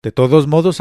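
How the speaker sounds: ordinary delivery, nothing marked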